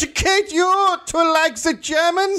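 high, hammed-up German voice; cartoon German announcer; mock German propagandist. Parodying the Bund's statement.

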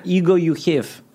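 put-on broken English in a light Russian accent; mock Russian accent. Russian God mocks Jeffrey's American picture of him, light Russian accent. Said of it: "I'm Russian".

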